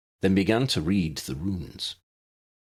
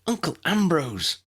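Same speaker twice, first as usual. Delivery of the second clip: put-on higher girl's voice to her uncle; girl calling uncle; 10 semitones higher than usual. A narrator's reading. Lydia turns to her uncle, higher girl's voice. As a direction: turn to your uncle, hopeful.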